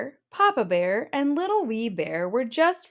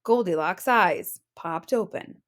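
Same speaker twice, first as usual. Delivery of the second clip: plain reading voice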